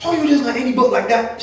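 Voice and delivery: Grenadian twang. Retold or imitated. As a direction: put on a Grenadian aunt's twang, indignant. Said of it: "with a bit more twang".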